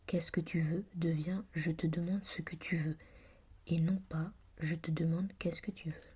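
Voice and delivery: ordinary talk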